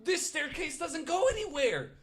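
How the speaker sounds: high, wailing mock ghost cry; frustrated ghost. Cohost voicing a lost ghost, wailing in mock frustration. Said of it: "the ghosts would be like".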